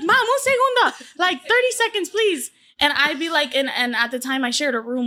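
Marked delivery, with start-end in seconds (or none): 0.0-2.5 high, laughing mock plea to mom; whiny pleading. Filming daughter begs her mom for thirty more seconds, high laughing whine. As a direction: beg for just a few more seconds. Teen begging mom to wait.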